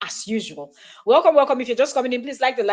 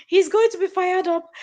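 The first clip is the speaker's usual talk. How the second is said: higher, amused, emphatic repeat; excited; 9 semitones higher than usual. Acted. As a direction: say it again with glee.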